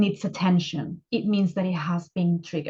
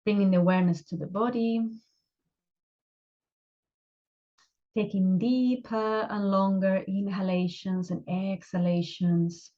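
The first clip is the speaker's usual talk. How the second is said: unhurried meditation guide, long pauses between phrases; guided meditation. Acted. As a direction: gently guide them into relaxation, leaving space after each instruction.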